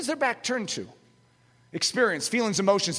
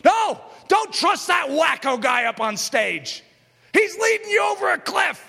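shouted, higher warning against the preacher; voice of doubt; 8 semitones higher, 4 dB louder than usual. Acted. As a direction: yell it like a panicked inner voice. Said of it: "all you hear is".

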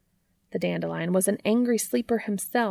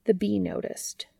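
ordinary delivery

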